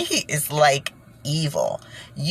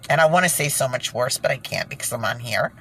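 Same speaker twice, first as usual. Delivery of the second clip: ordinary talk, nothing marked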